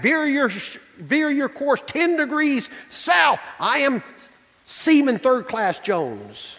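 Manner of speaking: high, slow shout of the order; mock shouted command; shouted reply. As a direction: insist right back at the captain. The reply insists back.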